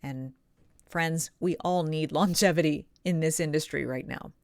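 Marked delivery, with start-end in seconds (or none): none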